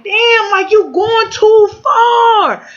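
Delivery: high, drawn-out mock complaint; exasperated protest. Partner telling her she goes too far, exasperated imitation. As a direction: imitate him pleading, stretched out and high-pitched.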